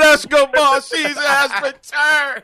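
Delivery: yelled. Acted. Host repeating his shout to the rapper, yelled. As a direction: belt it out like a triumphant hype man. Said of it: "I had to yell out".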